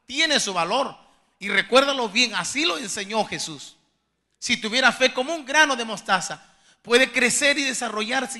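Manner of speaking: loud, emphatic sermon voice; preaching forcefully. Real, not acted. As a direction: drive the promise home to the congregation. (predicando con fuerza, en voz alta)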